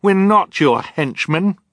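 high, loud, slow outcry; indignant cry. Acted. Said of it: "Violet cried".

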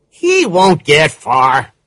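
a hint of a calm captain; police captain voice. A narrator's reading.

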